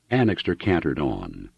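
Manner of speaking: usual speaking voice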